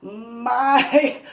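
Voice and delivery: high laughing voice, cracking up; giggling loud and high. Real, not acted. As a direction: laugh through the words. Laughing while recalling pregnancy.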